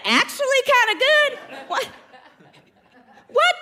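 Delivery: high, loud mock outrage; mock indignant. Wife pretending offense at husband's faint praise, loud and high. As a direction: pretend offense at a lukewarm compliment.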